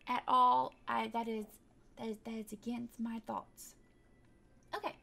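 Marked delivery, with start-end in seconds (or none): none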